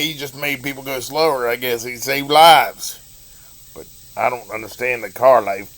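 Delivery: plain reading voice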